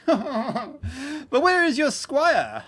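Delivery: laughing through the line, very slow; amused knight, laughing as he asks. Acted. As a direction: chuckle the question out. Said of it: "Sir Giovanni took his turn laughing".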